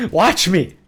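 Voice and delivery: high, loud playful boast; excited. Real, not acted. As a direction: crow over your play, loud and high. Showing off in the game.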